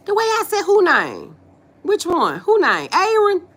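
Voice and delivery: puzzled, high rising queries; incredulous questioning. Real, not acted. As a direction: pitch it up as if taken aback. Questioned on how she says name.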